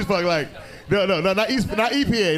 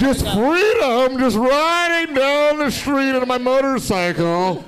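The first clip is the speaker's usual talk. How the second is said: mock biker dreamer; 4 semitones higher, 4 dB louder, slower than usual. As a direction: drift through it like a wind-in-hair biker ad.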